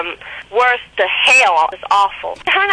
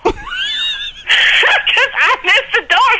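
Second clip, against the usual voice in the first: high, slow laugh through the words; much slower than usual. Real, not acted. Caller laughing at her own crash into the wall. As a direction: crack up as you say it. Laughing while telling the story.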